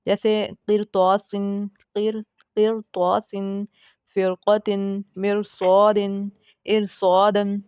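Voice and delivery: र in किरतौसिन said heavy and full-mouthed, slow. Shown as a demonstration. Teacher demonstrating heavy रॉ before emphatic letters. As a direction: thicken the र in मिरसौदिन and इरसौदन. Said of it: "हम रॉ को पुर ही पढ़ेंगे".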